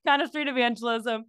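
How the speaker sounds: ordinary talk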